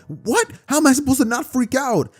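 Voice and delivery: high, frantic disbelief; freaking out. Acted. Boy arguing with the alien, high frantic disbelief. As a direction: protest in a high panic.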